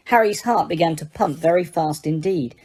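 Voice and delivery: usual speaking voice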